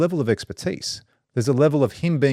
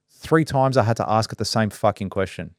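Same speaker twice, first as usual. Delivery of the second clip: normal talking voice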